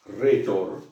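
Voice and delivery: "Retor" with a breathy H before the R, as if hretor; rough breathing on retor. Shown as a demonstration. Teacher models "Retor" with rough breathing. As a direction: push air out before "Retor". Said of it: "afectada de este espíritu áspero".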